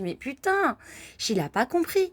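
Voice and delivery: she can't believe he still follows; exasperated disbelief. Retold or imitated.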